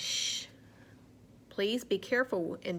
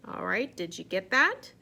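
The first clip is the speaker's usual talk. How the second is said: usual speaking voice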